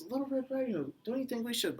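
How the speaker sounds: high, coaxing wolf voice. A narrator's reading. Wolf coaxes the girl.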